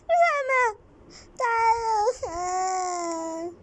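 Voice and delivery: high, loud sobbing call; crying out. Acted. Lost girl crying for her mother to come. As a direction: wail for mum, dragging it out. خلاص، بلاش عياط.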